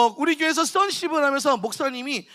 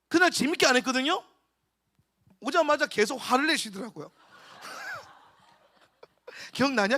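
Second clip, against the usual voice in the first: usual speaking voice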